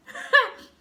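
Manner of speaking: sudden loud, high-pitched laugh; short bursting cackle. Real, not acted. Laughs after saying she's thirsty.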